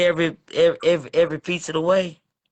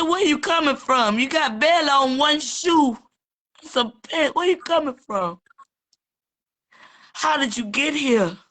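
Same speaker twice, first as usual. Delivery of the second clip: high-pitched retold astonishment; mock-interrogate him, pitch raised throughout; incredulous questioning; 6 semitones higher than usual.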